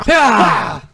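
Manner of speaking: loud, high action-hero yell; full-force mock fight cry; Jean-Claude Van Damme. Shown as a demonstration. Showing off the action star's yell, loud and high. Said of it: "I can do that pretty good".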